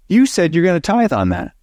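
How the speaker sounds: high-pitched retold accusation; retold yelling. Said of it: "and he's yelling at me".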